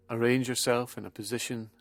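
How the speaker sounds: soft, hushed meditation voice with long pauses; guided relaxation. Acted. Leads a relaxation session.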